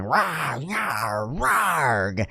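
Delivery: high playful kiddie roar; kids' roar. Retold or imitated. Kids roaring at their toy dinosaur, high playful growl. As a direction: growl like a small kid at his toys. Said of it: "I hear the kids going".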